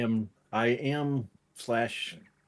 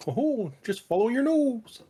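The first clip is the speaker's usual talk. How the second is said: high cartoon bird mascot voice; Toucan Sam; 12 semitones higher, 4 dB louder than usual. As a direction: pitch way up like the cereal toucan. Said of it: "You sounded a bit like Toucan Sam".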